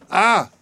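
sudden high shocked "Ah!"; wife spots husband kneeling in doorway, frenzied cry. A narrator's reading. A sharp, high gasp of a cry. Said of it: "she cried out in a frenzy".

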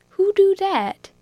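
high, loud question; startled steward. A narrator's reading. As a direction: ask in shock.

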